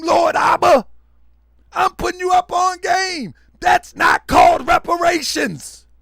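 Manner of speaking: fired-up shouting at the family; loud ranting. Real, not acted. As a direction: preach it loud, hammering each point. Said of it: "I know I'm loud".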